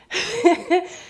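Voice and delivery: a real high burst of laughter. Real, not acted. Amused at her own retold shock, a high real laugh.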